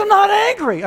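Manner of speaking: very high, exasperated wife outburst; mock angry denial. Wife pressed by husband about anger, high-pitched mock-angry denial. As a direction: deny anger while audibly exploding. Wife's exploding denial, retold.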